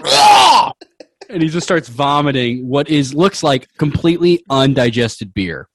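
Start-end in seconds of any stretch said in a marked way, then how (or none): 0.1-0.7 sudden high yelp of surprise; startled whoa. Acted. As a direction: shout as he wakes with a jolt. Uncle jolts awake, exclaims.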